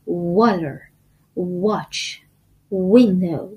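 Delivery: "water", "watch", "window" as separate words with a firm round w. Shown as a demonstration. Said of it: "باید لباتون رو گرد کنه".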